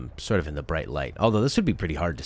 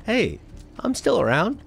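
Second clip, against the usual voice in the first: high, bouncy cartoon hero voice; jokey, lilting Aladdin impression; cheery Aladdin; 4 dB louder than usual. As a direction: sing it out like a carefree cartoon street kid. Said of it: "he'd be like".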